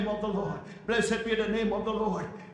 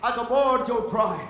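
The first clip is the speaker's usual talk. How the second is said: ordinary talk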